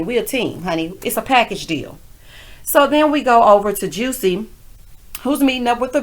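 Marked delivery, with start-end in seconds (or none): none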